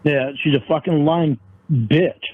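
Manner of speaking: ordinary talk, nothing marked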